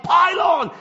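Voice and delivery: high-pitched imitated playground yell; mate's shout. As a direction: call it out high, rallying the other kids. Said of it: "of a mate shouted".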